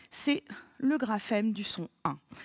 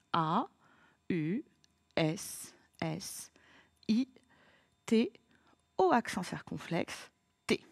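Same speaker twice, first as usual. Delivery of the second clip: each letter said slowly and low, apart; slow spelling aloud; 3 semitones lower, slower than usual. Shown as a demonstration. Teacher spells the word together with the pupil, slowly. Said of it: "On y va".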